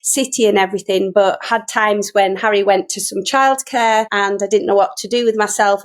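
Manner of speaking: ordinary talk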